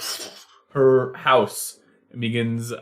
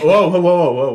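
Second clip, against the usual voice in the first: louder, higher whoa; startled seagull; 6 semitones higher, 7 dB louder, much faster than usual. Acted. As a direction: protest being shooed. Seagull shooed away.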